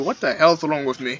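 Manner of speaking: ordinary talk, nothing marked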